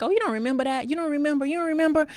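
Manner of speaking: high, rising mock disbelief; teasing. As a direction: tease mom for forgetting. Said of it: "they tease me like".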